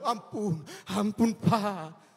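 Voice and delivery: slow, loud drawn-out pleading; child begging father for mercy; mock child begging. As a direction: wail it out like a kid about to be caned. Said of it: "Kalau sudah di rotan, barulah".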